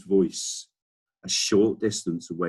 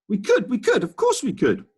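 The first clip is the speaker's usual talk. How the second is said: much higher, louder eager chorus; eager shout; 6 dB louder than usual. Acted. Animals eagerly answering the Duke, much higher and louder. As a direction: answer all together with glee. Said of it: "we all shouted".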